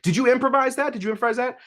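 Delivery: very high-pitched mimicked question; high, repeated mock-excited questioning; mock eager interviewer. Mimicking gushing podcast hosts' question.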